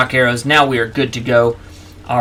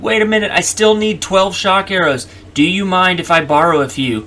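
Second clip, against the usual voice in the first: higher, pleading mock protest; mock plea; 8 semitones higher, 4 dB louder than usual. Player imagining being spotted by the enemy, mock pleading. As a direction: call out an imagined request, pitched up. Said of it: "then I'd be like".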